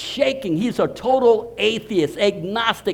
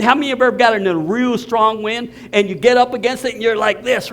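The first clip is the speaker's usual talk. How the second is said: normal talking voice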